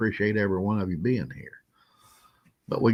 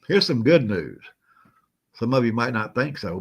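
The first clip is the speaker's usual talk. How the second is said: usual speaking voice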